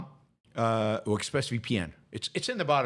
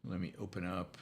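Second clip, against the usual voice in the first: raspy, worn-out voice; hoarse voice; 4 semitones lower, 7 dB quieter than usual. Real, not acted. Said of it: "I'm losing my voice a little bit".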